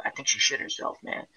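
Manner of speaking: hushed conspiratorial whisper; secretive whisper among coworkers. Retold or imitated. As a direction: lean in and whisper it.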